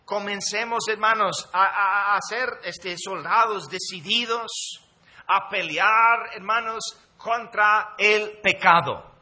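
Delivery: loud, slow preacher's call to arms; rally the congregation like a preacher; loud pulpit exhortation; fiery preaching. Acted. Preaching at full force.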